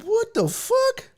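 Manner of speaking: high, drawn-out disbelief; mock shock. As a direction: stretch it out in stunned disbelief. Shocked reaction to test results.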